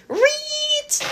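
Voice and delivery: long piercing squeal, pitch shot up high; high boar squeal. A narrator's reading. Giant boar squealing at the skeletons, high squeal. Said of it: "it squealed".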